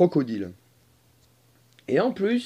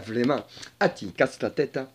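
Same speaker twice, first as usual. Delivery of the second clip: ordinary talk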